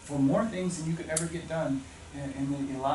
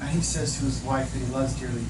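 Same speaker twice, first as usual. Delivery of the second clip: ordinary talk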